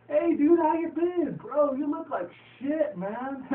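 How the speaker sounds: loud, very high-pitched bro imitation; hammed-up rowdy greeting. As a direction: holler it like an over-excited buddy.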